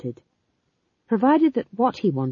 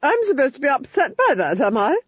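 higher, mocking villainess voice; scornful Duchess; 8 semitones higher, 4 dB louder than usual. Duchess mocking Granny's attempt to shame her, high scornful sneer. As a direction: sneer down at the old witch.

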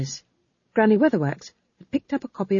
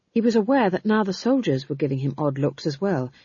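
ordinary delivery, nothing marked

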